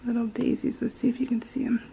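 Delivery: hushed whisper, a bit quick at the end. Real, not acted. Showing viewers the tiny daisies, whispering. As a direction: whisper over the tiny flowers.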